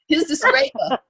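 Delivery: amused, squeaky repeat of the stranger's line; laughing retake. Retold or imitated. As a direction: crack yourself up on the retry.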